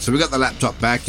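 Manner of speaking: ordinary talk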